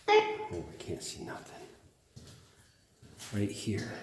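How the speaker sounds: startled oh, then whispered; jump, then hush in the dark; nervous night vlog whisper. Real, not acted. Dark room, startled then hushed.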